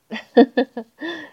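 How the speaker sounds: giggle. Acted. Narrator laughs between lines.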